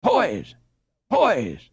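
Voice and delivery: high urgent call to wake; shouting. A narrator's reading. Boy calling sleeping friends while holding robber, shouting. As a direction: rouse the sleepers. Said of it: "he shouted".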